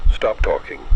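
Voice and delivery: ordinary delivery, nothing marked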